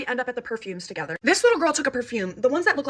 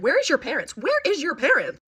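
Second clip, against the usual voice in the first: high exasperated question, slower on repeat; exasperated demand; 5 semitones higher, 4 dB louder than usual. Real, not acted. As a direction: demand it, then stretch it higher. Exasperated outburst about absent parents.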